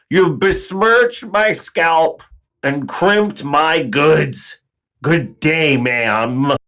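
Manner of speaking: offended gentleman bidding good day; pompous indignation. Acted. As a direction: drag out each word like an offended gentleman. Pompous farewell to Susie.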